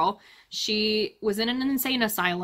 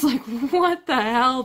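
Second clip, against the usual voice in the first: louder, drawn-out exasperation; mock outrage; 3 semitones higher, 5 dB louder, slower than usual. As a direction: stretch it out in loud disbelief.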